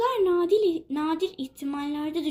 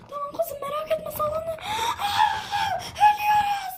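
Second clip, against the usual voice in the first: high sobbing shrieks; crying panic; 13 semitones higher than usual. Acted. Daughter cries to mother that the rudder broke, sobbing panic. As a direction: wail in terror to your mother.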